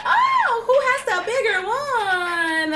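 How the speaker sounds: very high, slow, laughing taunt; competitive rivals. Retold or imitated. Rival actors competing, slow high mock taunt. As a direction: drag out the taunt. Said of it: "it becomes like".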